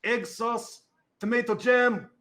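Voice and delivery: high, loud North Country holler; little high-pitched cook calling out; tiny yelling kitchen voice. Acted. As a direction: yell the order as a tiny voice from the kitchen. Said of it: "this tiny little voice from the kitchen, North Country voice, yelling".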